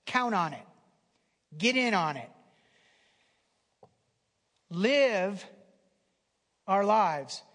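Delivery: loud exhortation, punchy short commands; rally the congregation; full-force preaching. Real, not acted. Preacher urging listeners to trust God's kingdom, loud exhortation.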